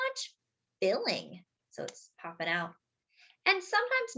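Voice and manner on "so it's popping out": ordinary delivery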